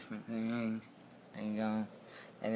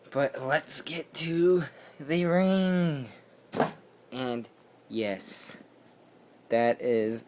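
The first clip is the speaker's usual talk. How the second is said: higher, slow, announcer-style build-up; hyped-up announcement; 4 semitones higher, 7 dB louder, slower than usual. Acted. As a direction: drag it out like a ring announcer. Hyping up the ring reveal.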